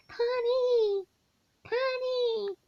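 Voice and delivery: high, loud repeated call of a name, each falling at the end; mom hollering. Retold or imitated. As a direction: call her name like her mother waking up and needing her. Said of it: "she's gonna holler".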